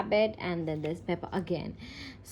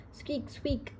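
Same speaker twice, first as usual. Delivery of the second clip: small, soft, higher-pitched squeaks; rabbit squeak; 6 semitones higher, 6 dB quieter than usual. A narrator's reading. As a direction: squeak lightly like a storybook rabbit. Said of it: "cries Rebecca Rabbit".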